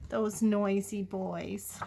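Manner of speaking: plain reading voice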